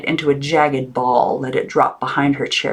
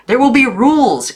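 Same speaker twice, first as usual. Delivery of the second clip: high, loud proclamation; stern aunt; 9 semitones higher, 6 dB louder than usual. A narrator's reading. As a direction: lay down the law. Aunt voiced in read story.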